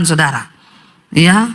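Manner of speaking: ordinary delivery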